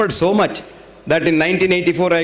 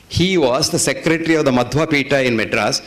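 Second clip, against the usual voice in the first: ordinary talk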